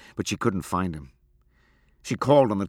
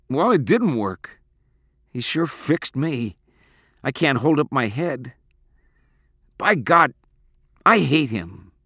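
louder, higher, heated outburst; bitter anger; 7 semitones higher, 6 dB louder than usual. A narrator's reading. Boy railing against his father, bitterly angry. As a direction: hit each phrase with resentment.

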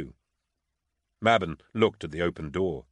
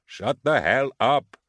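higher, louder, slow and forceful; furious; 6 semitones higher, 4 dB louder, slower than usual. Acted. Panicking guard ordering the prisoner silent, furious. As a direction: spit each word out, at the end of his rope. Guard losing his temper.

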